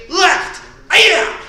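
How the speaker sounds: sharp high martial-arts yell; kiai shout. Shown as a demonstration. Instructor leading class drill, loud kiai shout. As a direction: shout the strike out from the gut.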